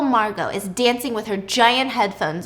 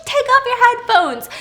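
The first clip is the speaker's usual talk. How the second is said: much higher and louder, frantic yelling; panicked scream; 13 semitones higher, 5 dB louder than usual. Retold or imitated. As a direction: scream at a neighbor who can't hear. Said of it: "So Pippa's screaming".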